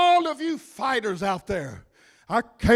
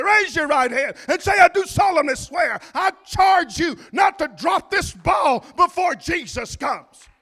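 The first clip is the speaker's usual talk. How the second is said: high and loud shouted exhortation; preacher shouting at the congregation to wake up; full-force preaching; 8 semitones higher, 4 dB louder than usual. Acted. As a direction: wake the sleeping pews up.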